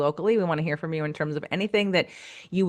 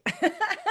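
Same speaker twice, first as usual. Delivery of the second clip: short high laugh; real, quick burst of high laughter; 3 dB quieter than usual. Real, not acted. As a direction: laugh lightly, pitched up. Laughs after psyched remark.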